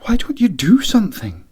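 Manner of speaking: urgent whisper. Acted. Siobhan in the back hall, urgent whisper.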